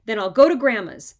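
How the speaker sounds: loud stubborn retort; defiant kid threat. A narrator's reading. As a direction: throw it back at Mom.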